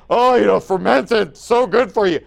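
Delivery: loud, gushing parody; mocking imitation. Preacher parodying fans of fermented drinks, mocking. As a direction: exaggerate it to ridicule the claim. Mocking claim that alcohol is healthy.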